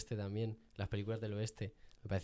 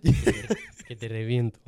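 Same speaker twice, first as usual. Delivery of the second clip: laughing threat; 8 dB louder, slower than usual. Pretend. Playful threat amid laughter.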